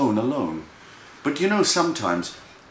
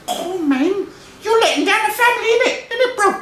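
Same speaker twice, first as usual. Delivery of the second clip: very high, fast squealing mouse; outraged little brother; 5 dB louder, faster than usual. Acted. Little brother scolds Freddy about the family, shrieky and high. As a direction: squeal it loud in street slang.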